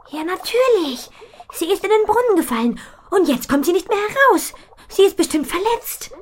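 put-on the girl realises her foal is hurt, alarmed. Mädchen erkennt das Unglück. (hohe, laute Mädchenstimme, alarmiert)

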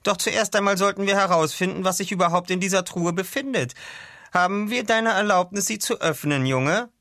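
high-pitched doctor voice, formally courteous; Doctor Skamandros, measured. Acted. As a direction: politely ask the boy's permission.

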